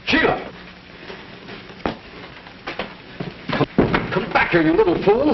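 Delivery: high-pitched panicky shout; alarmed calling out. Acted. Husband calling wife back from a ledge, alarmed cry. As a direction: call her back urgently, voice leaping up.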